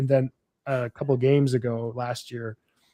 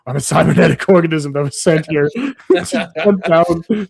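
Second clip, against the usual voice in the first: robot voice with laughs; 3 semitones higher, 5 dB louder than usual. Pretend. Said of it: "you can be like".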